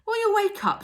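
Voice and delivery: high loud shriek. A narrator's reading. Maud shrieking at half-asleep Mildred: high loud shriek. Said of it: "shrieked Maud".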